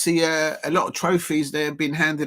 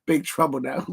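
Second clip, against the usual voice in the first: laughing aside; 5 semitones lower, 3 dB quieter, faster than usual. Real, not acted. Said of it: "I'm gonna get in trouble".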